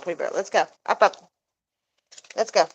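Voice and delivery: ordinary talk, nothing marked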